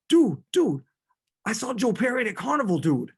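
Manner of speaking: high, fast, loud Boston dude; terrible Boston accent. Acted. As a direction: rattle it off like an excited Boston stoner. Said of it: "terrible Boston accent".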